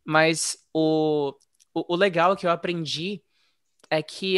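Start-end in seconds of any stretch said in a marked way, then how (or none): none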